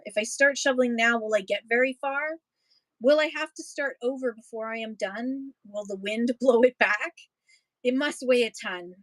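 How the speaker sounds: steady, rhythmic poem read-aloud. Acted. Reading a prairie poem to an audience, rhythmic read-aloud. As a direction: read the verses with a lilting beat for listeners.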